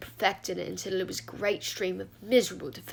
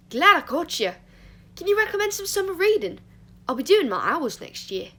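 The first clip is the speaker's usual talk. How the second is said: loud, higher, eager student chatter; relentlessly cheerful; 9 semitones higher, 6 dB louder than usual. A narrator's reading. Christopher asking Remus for summer reading, relentlessly cheerful. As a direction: chirp it brightly, full of eagerness. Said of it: "relentlessly cheerful".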